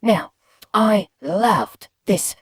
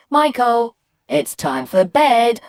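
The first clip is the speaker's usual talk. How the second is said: higher, louder mum voice with drawn-out ending; mum calling bedtime; 6 semitones higher, 5 dB louder, slower than usual. Acted. Mum telling Michael to go to bed, firm call. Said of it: "So when my mum said".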